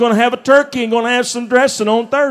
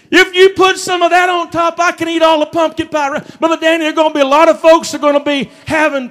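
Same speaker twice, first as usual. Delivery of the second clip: preaching at full force; 6 semitones higher than usual. Acted. Pastor firing up the congregation about Thanksgiving, full-throated preaching. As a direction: lift the room with a preacher's holler.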